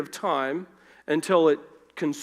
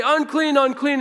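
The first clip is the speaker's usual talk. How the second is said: high, louder warning cry of unclean; shouted unclean; 9 semitones higher, 5 dB louder than usual. Retold or imitated. Leper who would cry out unclean, high and loud. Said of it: "he doesn't say".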